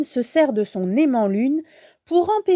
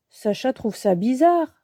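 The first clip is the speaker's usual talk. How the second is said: plain reading voice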